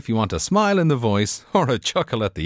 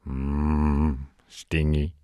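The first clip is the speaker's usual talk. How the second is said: low voice; 7 semitones lower, 3 dB quieter, much slower than usual. Acted. Low voice after the device.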